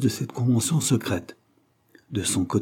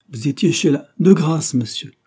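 louder, higher plea cutting him off; frantic interruption; 6 semitones higher, 6 dB louder than usual. A narrator's reading. The countess cutting off her husband, begging the lawyer. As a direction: break in to stop him talking.

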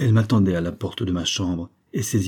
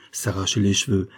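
plain reading voice

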